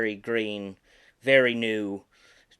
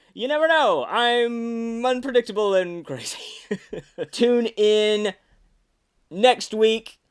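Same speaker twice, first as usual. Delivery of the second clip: higher, louder playful dummy host; Slappy sign-off voice; 11 semitones higher, 6 dB louder than usual. Acted. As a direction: tease the viewers like a mischievous doll. Signs off in character.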